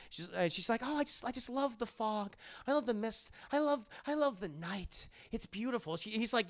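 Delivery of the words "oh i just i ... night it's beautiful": a touch dreamy, higher and soft; lightly voiced entranced Mina. Mina telling Jonathan about the night, mildly dreamy. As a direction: lightly imitate a spellbound woman sighing.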